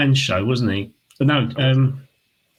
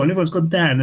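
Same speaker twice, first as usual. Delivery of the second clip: ordinary talk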